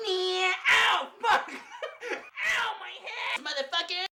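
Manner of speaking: high shouted cartoon yelps; Bugs Bunny voice. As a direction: yelp like a hurt cartoon rabbit. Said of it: "He'd be like".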